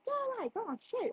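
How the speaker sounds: high-pitched shooing voice; squawked, acted telling-off; Missus K. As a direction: shoo them off in a high put-on voice. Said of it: "then Missus K".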